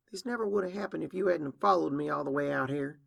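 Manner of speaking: annoyed blame. Acted. Acted sisters' quarrel in the well.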